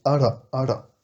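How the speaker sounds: "ara" with a tapped R in the middle; rolled r. Shown as a demonstration. Teacher showing the rolled R in "ara". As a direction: tap the R in "ara". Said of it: "Un R roulé".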